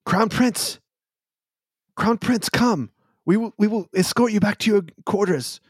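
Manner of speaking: high, urgent palace guard. Acted. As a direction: call out as an anxious guard. Guards urging the crown prince.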